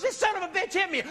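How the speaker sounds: high-pitched mimicked complaint; acted accusing wife. Wife accuses him to cops.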